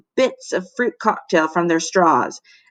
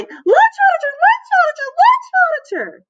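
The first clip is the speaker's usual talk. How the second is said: much higher, louder frantic cry; cried louder; 4 dB louder than usual. A narrator's reading. Melvin begging classmates to heed him, high frantic cry. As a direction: shriek the warning even harder. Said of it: "cried even more loudly".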